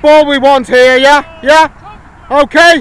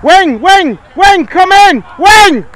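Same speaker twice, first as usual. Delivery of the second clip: higher, louder shout across the pitch; yelling from the sidelines; 6 semitones higher, 5 dB louder, slower than usual. Real, not acted. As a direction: call the winger in, full voice. Coach calling to winger on pitch.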